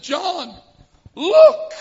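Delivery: high sharp yell of the name, then look; urgent shouted warning to his best man; cry out. Retold or imitated. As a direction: shout it to make him see the blade. Stops knife, cries out to friend.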